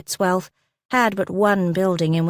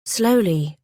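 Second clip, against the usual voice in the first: ordinary delivery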